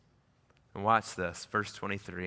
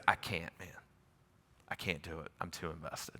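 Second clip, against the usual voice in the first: soft tearful refusal; 7 semitones lower, 11 dB quieter than usual. Retold or imitated.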